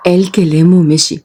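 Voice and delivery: ordinary delivery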